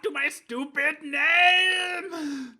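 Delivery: loud, slow exasperated cry; frustrated shout. Acted. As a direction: yell it in comic despair. Mock complaint of the character.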